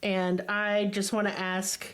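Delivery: usual speaking voice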